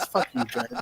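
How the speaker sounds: laughing. Real, not acted. Friend teasing, laughing.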